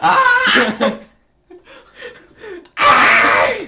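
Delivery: high, loud laughing shriek, then an unvoiced yell; sound effect scream. Host makes the servant's sound effects with loud laughing screams. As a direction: scream like a clumsy sneaking servant. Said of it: "Can you make those sound effects too?".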